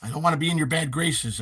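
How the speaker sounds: ordinary talk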